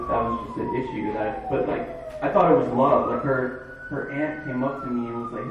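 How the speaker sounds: ordinary talk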